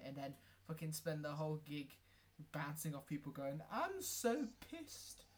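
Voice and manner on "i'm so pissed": high, slow slurred mock drunk kid; drunk kid impression. Mocking drunk kids at the Bodega, high slow slurring. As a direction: drag it out like a wasted teenager.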